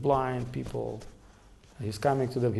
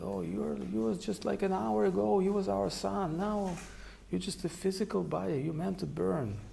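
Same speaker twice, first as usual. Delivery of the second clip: mourning parents; 7 semitones higher, 5 dB quieter than usual. Retold or imitated. Parents mourning over son's body.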